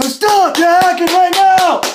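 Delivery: high, loud angry order; upset yell. Acted. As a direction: yell at them to quit. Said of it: "I'm getting really upset".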